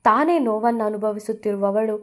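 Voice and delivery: ordinary delivery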